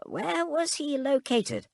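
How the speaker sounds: high, slow, thin crone voice; high creaky old woman voice. A narrator's reading. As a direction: voice her like an eager old servant. Hook's eager question, character voice.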